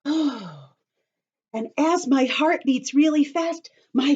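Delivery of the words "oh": ordinary talk, nothing marked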